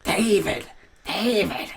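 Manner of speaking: high, throaty strained voice. Retold or imitated. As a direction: croak the name out like a lizard. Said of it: "you said you were gonna do an impression".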